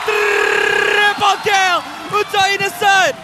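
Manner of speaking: slow, stretched, loud kill call; fighting game announcer. Acted. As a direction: draw out each word like an arena announcer. Triple kill called out. (gaya juruhebah permainan pertarungan)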